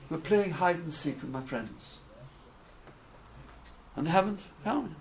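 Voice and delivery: put-on quiet, understated little boy's hurt; mildly sad child. Little boy explains his tears to the rebbe, quietly sad. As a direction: answer softly, a touch forlorn.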